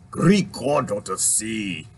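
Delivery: slow, low mock Irish accent; lightly put-on Irish accent, drawn out. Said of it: "I'm gonna tap into my Irish".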